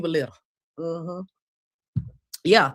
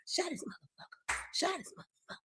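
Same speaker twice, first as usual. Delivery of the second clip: hushed, fully whispered request; barely audible whisper, repeated insistently; whispered plea; 9 semitones higher, 16 dB quieter than usual. Real, not acted. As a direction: whisper it like a secret instruction.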